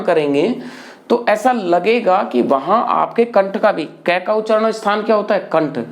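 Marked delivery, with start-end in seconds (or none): none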